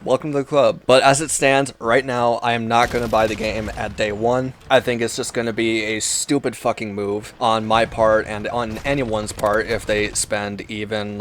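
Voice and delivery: normal talking voice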